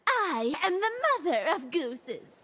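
ordinary delivery

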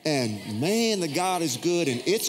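high, drawn-out encourager's voice; Joel Osteen. Retold or imitated. TV preacher encouraging all of Yankee Stadium, mock uplift. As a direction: imitate a TV preacher cheering on a crowd. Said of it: "But he starts encouraging".

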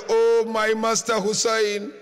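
normal talking voice

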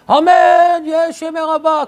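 loud, high, drawn-out amen; mock congregational shout. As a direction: bellow it like an over-eager congregation. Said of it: "כל הזמן צועקים". (צעקת תפילה מוגזמת)

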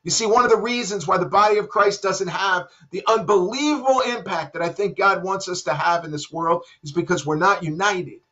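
normal talking voice

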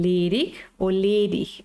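"ledig" first ending in k, then in ch, slowly; two pronunciations. Shown as a demonstration. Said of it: "Depende de las zonas".

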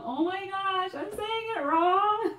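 high, slow squeaky mock despair; mock wail. Real, not acted. Told the name is still wrong, wailing high and slow. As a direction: wail it out in high comic dismay. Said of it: "You are still saying it wrong".

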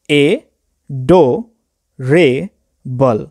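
ए, डो, रे, बल with a clear pause after each syllable. Shown as a demonstration. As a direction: break it into four separate beats: ए... डो... रे... बल. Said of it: "pause लेना पड़ता है".

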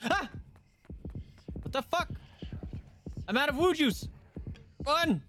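high panicked yelp. Real, not acted. Out of juice, fleeing.

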